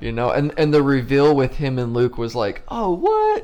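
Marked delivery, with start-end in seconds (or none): 2.7-3.4 high-pitched surprised reaction; mock astonishment. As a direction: squeal a playful shocked question. Retells shocked reaction to the reveal.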